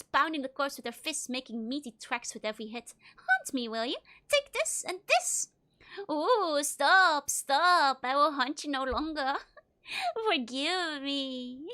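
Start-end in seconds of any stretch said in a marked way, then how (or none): 3.2-5.5 angry crone. Acted. Martha punching the corpse, high angry yell. 6.1-11.7 louder hammed-up ghost begging; mock ghost pleading. Player as the corpse pleading with Martha, mock ghost.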